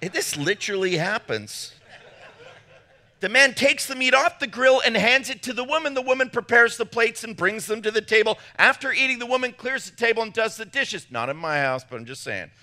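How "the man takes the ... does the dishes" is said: higher, emphatic list-reading voice; reading aloud. Acted. Pastor reading who does all the work, emphatic reading aloud. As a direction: build the comic list step by step.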